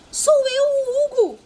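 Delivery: high, loud pleading shout; desperate shout. A narrator's reading. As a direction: shout after him in distress. (grito desesperado e agudo)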